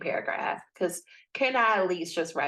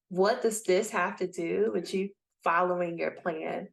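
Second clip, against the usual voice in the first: ordinary talk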